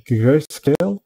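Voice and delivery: Grayscale said in an English accent; English-sounding Grayscale. Shown as a demonstration. Tutorial host saying the profile name Grayscale in an English accent. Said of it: "mon accent anglais".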